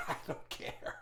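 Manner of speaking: high, slow laugh into the words. Real, not acted. Dad dismissing complaints about no, laughing. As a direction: laugh it off as you speak.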